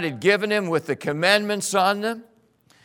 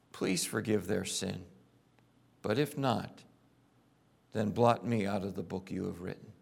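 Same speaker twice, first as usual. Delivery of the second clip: hushed plea; 11 semitones lower, 10 dB quieter than usual. Acted. Moses finally prays at summit.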